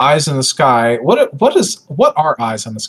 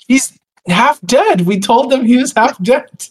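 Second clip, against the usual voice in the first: in-character exasperation; 10 semitones higher, slower than usual. Acted. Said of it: "nail down my character voice".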